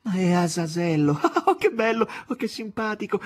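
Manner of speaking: high, loud and laughing; delighted exclamation. Acted. Margherita greeting Azazello, high and delighted. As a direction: burst out in joy. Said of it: "esclamò".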